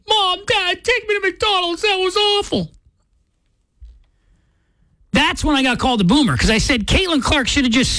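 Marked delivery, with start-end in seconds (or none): 0.1-2.7 put-on high, whiny child begging the parents; a kid complaining in the back seat, voice pitched up. Child in the car begging parents for McDonald's, whiny high voice. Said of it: "you say".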